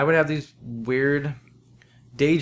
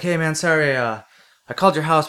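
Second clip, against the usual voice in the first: hyper; 6 semitones higher, 6 dB louder than usual. Retold or imitated. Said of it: "He's all hyper and shit".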